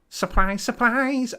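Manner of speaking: a hint of playful Scouse; Liverpool accent. Host announcing the show's title, in a Liverpool accent. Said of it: "he can't do a Liverpool accent".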